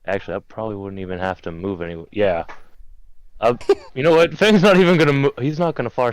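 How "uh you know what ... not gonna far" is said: loud, high burst of excitement; excited. Real, not acted. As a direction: blurt it out, thrilled. Sudden excited decision.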